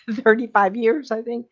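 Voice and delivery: laughing through the words; a real chuckle breaking into it. Real, not acted. Laughing at own long absence.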